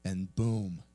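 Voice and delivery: ordinary talk, nothing marked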